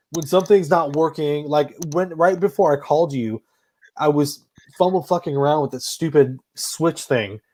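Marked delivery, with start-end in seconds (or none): none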